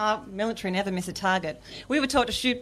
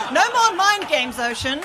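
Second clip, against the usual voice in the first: much higher, louder defiant shout; 6 semitones higher, 6 dB louder than usual. Acted. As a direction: yell it at the sea. Yelling at the ocean.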